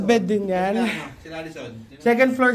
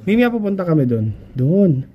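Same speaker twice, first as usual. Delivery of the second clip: calling out; 3 semitones lower, 8 dB louder than usual. Real, not acted. Calling to Mimi across the house.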